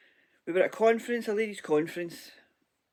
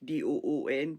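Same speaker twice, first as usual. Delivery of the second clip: ordinary delivery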